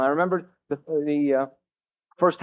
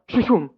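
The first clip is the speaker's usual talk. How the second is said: rhythmic imitated tape flapping, rising a little; playful mock tape sound; broken tape noise; 3 semitones higher than usual. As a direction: mimic a snapped reel spinning, laughing through it. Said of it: "every time it went".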